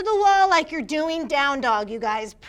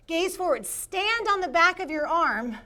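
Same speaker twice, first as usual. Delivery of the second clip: ordinary talk, nothing marked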